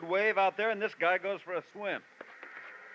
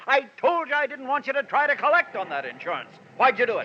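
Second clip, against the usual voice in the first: rising, louder accusing tone; tearing into his wife over the insurance money; angry scolding; 6 semitones higher, 4 dB louder than usual. Acted. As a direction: berate her, building to a demand. Husband berates wife over insurance.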